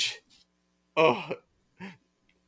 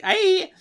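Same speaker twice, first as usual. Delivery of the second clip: mock pained yelp; 7 dB louder than usual. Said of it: "She's like".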